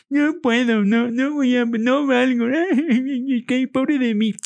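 high, self-mocking idiot whine with laughter; squeaky finger-in-nose fool, breaking into a laugh; imbecile voice. Shown as a demonstration. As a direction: ridicule your own self-pity in a high silly voice. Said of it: "con ese tono".

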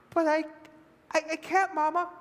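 put-on high, halting child voice; scared little boy. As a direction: stammer it like a reluctant small boy. Scared boy refuses his mother.

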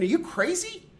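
high disbelieving are you crazy; mock-shocked are you crazy; incredulous. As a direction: think it in disbelief. Retold inner incredulous thought.

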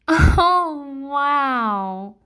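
high, loud, drawn-out mock amazement; a big long "oh, wow" sliding slowly down. Shown as a demonstration. Woman demonstrates a response to nitpickers, mock amazement. Acts out unbothered response to insults.

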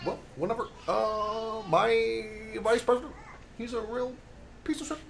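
Obama impression. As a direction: drift up high and soft. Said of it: "give me Barack Obama".

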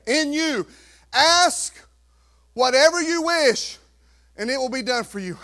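loud, high, deliberate sermon read; booming pulpit proclamation; preached scripture reading. Acted. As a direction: proclaim the verses to the church. Reading scripture at full force.

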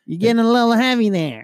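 high, loud scolding grandma; grandmother voice. Retold or imitated. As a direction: tease like a pushy grandma.

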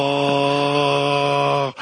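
a low sung blah, hammed up; mock sung blah. Imitating a weird fast food ad, sung blah. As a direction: croon one lazy blah. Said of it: "someone just going".

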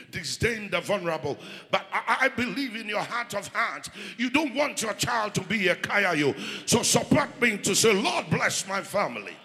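loud fervent preacher, pushing hard; preaching at full force. Acted. Preacher urging the congregation.

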